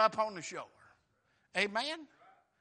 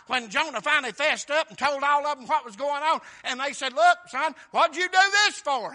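loud, high, driving sermon storytelling; full-force preaching; 4 semitones higher, 10 dB louder than usual. Real, not acted. Preacher telling how the storm exposed Jonah, full-force preaching. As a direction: build the story at the top of your voice.

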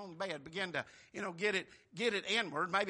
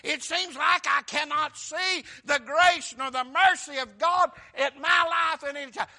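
loud, slow, higher pleading; preached lament; 5 semitones higher, 11 dB louder, slower than usual. Acted. Preacher pleading the psalmist's doubt before his congregation.

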